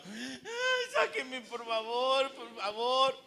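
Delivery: high, soft, slow half-laughing whimper; mock whimpering plea. Suffocating man begging people to get him out, whimpering. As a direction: whine to be let out, hammed-up.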